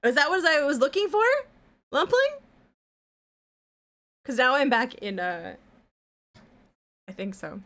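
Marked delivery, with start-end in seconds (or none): 1.9-2.4 surprised. Real, not acted. Surprised call-out of name.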